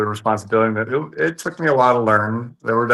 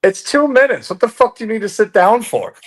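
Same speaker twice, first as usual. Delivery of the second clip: high-pitched, exasperated protest; incredulous scoff; 11 semitones higher, 3 dB louder than usual. Real, not acted. Promoter asked about standing between rounds, incredulous outburst. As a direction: protest it as if it were absurd.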